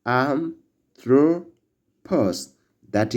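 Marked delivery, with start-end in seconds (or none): 0.0-1.5 anthropos said slowly and a bit higher, AN-thro-pos; slow Greek pronunciation. Shown as a demonstration. Teacher leading class repetition of Greek word, slow. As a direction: lead the class through anthropos once more.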